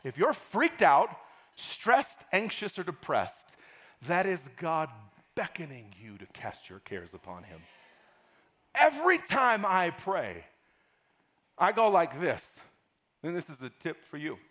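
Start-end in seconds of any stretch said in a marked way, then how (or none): none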